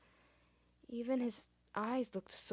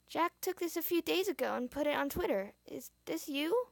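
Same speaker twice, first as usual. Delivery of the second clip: put-on kid voice; 7 semitones higher, 3 dB louder than usual. A narrator's reading. Kid character asks.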